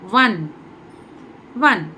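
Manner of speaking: "ஒன்" as a firm, separate "wun"; consonant-first "one". Shown as a demonstration. Teacher showing one has no vowel sound, firm "wun". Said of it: "vowel sound இல்ல".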